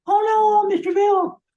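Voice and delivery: very high, loud cartoon falsetto; Mister Bill. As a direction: squeal it like a panicked clay puppet. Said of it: "when I called him Mister Bill".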